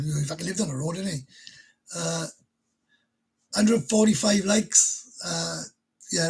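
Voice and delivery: ordinary delivery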